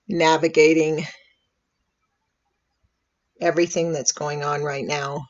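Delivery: calm, unhurried meditation voice with long pauses; soothing, measured invitation to breathe and settle; guided meditation. Real, not acted. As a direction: gently guide listeners into relaxing, leaving space after each phrase.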